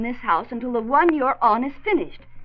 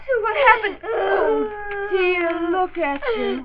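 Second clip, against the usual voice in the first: higher, tearful worry; distressed; 7 semitones higher, slower than usual. Acted. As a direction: fuss over her hurt child. Mother over her hurt daughter.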